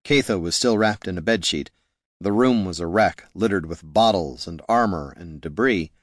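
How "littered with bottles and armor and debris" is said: ordinary delivery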